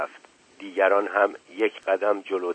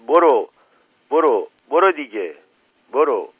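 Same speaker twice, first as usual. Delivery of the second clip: loud, punchy repeated calls; crowd shouting; 5 semitones higher, 6 dB louder than usual. A narrator's reading. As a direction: shout like a crowd driving someone away. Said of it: "و جماعت داد زدند". (داد زدن مثل جماعت)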